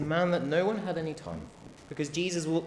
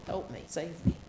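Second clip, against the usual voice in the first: desperate plea; 5 semitones higher, 3 dB quieter than usual. Acted. Preacher voices beggar crying out.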